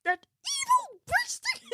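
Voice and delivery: very high spiteful diva; very high mocking insult; Miss Piggy snarl. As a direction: sneer it in a high pig voice. Piggy mean toast retort.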